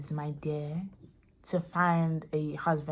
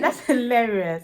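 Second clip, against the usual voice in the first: laughing it out, higher and slow; 6 semitones higher, 4 dB louder than usual. Real, not acted. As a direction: laugh through the words. Friend's joke cracks her up.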